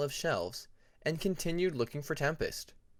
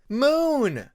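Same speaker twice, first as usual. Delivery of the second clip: high, loud joyful cry of a name; happy exclamation; 11 semitones higher, 10 dB louder than usual. A narrator's reading. Dragon greeting his oldest friend, loud and joyful. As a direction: call out in delight at an old friend. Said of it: "exclaimed, happily".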